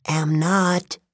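high, huffy tree spirit; muffled voice from inside a tree; muffled dryad voice. Acted. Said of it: "a muffled voice said from the tree".

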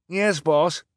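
high, loud eager reply; obedient henchman. A narrator's reading. Henchman obeys his boss at the car, eagerly. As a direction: answer the boss promptly.

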